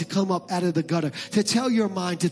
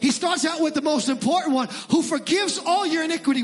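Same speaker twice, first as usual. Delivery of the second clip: high, rising shouted preacher cadence; preaching at full force; 11 semitones higher than usual. Acted. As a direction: build to a roar against shame. Preaching climax on shame.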